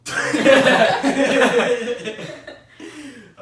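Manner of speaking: loud, high laughing burst; real explosive laugh; bursting into laughter. Real, not acted. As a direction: crack up hard, then let it tail off. Group cracks up after absurd story.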